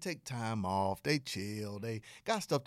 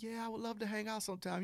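lightly voiced woman, pitched up; a hint of a higher female voice; imitated woman; 9 semitones higher, faster than usual. Woman agreeing to hang out with a suitor, lightly imitated. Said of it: "And she's like".

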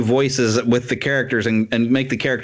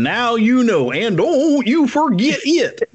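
slow, much higher, hammed-up narrator sign-off; mock announcer voice; 10 semitones higher, slower than usual. Co-host closes the trivia bit in a slow, hammed-up announcer voice. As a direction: deliver it like a grand TV announcer, drawn out. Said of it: "that's a pretty good voice".

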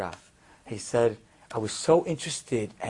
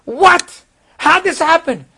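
very high, loud stunned outburst; father can't believe it; shocked disbelief; 12 dB louder, slower than usual. Retold or imitated. Father hearing son say he's religious, loud shocked disbelief.

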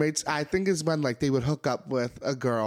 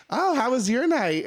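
bright, higher, fake-innocent; teasing chipper; 9 semitones higher, 3 dB louder than usual. Roommate teasing the morning guest with mock chipper cheer. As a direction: needle them sweetly.

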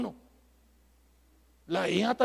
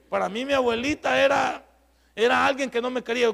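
"Para mí mi abuelita era" said again, louder and higher; preacher restates "abuelita era" louder to the congregation, louder emphatic restatement; 4 semitones higher, 6 dB louder than usual. Real, not acted. Raise the voice on the repeat. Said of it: "Para mí mi abuelita era el anticristo".

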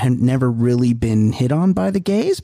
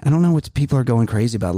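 ordinary talk

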